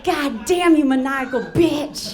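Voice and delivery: high, drawn-out theatrical curse; hammed-up outrage, slowing at the end; mock-outraged admiration. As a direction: curse her in exaggerated awe. Acted awe at her scheming.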